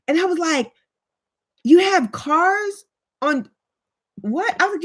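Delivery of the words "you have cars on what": slow, high-pitched disbelief; acted astonishment. Retelling her ignorant question to African friend, mock astonishment. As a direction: play stunned, stretching the words out.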